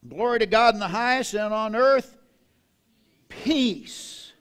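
loud, ringing proclamation; preacher booming the true verse; proclaimed verse. Shown as a demonstration. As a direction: declare it the right way. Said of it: "let's read it the way it's written".

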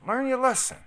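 high, loud exasperated cry; loud disbelieving learn your lesson; outraged yell. Real, not acted. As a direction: yell it at the screen. Outburst at news.

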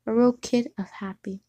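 plain reading voice

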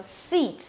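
"seat" with long falling ee; careful long-i "seat"; long vowel demo. Shown as a demonstration. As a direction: hold the tense vowel in "seat". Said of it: "âm dài phải đọc là gì?".

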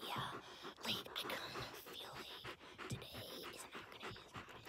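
soft breathy whisper as the dog; dog's inner voice. Acted. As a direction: whisper the dog's wise words. Voicing the dog.

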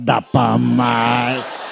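very slow, low echo; low drawn-out retort. Acted. Son answering mother back, low slow drawl. His mocking reply to mother.

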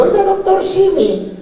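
high, put-on gringo English; hammed-up fake English; mock English accent. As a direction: ask it like a foreigner trying English. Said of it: "le pregunté en inglés".

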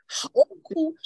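mock wail. Shown as a demonstration. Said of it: "要嚎出声音来的那种叫wail".

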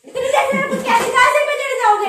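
exasperated outburst. Real, not acted. Outburst after being knocked into wall.

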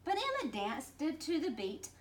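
ordinary delivery, nothing marked

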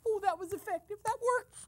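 very high, squealing delight; giddy glee. Pretend. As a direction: crow over the successful prank in a squeal. Delighted that the prank worked.

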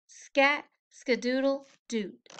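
plain reading voice

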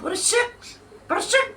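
high teasing sing-song. Real, not acted. Teasing a commenter.

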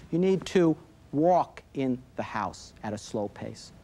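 put-on low, slow, measured direction to a child; calm instruction. Shown as a demonstration. Parent giving a child positive direction, calm and slow. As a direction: model a calm parent, spacing the words out.

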